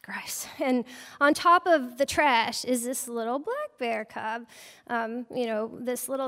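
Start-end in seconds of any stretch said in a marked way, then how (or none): 0.0-0.5 disgusted whisper. Retold or imitated. Biologist peering into a garbage truck, disgusted whisper. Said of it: "I'm like".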